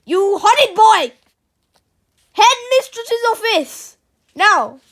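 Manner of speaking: very loud, high scolding; enraged teacher at full volume; furious shriek. A narrator's reading. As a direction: shriek the orders in a rage. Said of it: "shrieked Mister Nurden".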